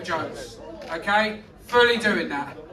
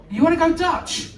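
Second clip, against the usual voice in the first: higher-pitched retold disbelief; mock incredulous; 8 semitones higher, 3 dB louder than usual. Man questions his date's offer, mock incredulous. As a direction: query it as if taken aback. Retold date, queries her suggestion.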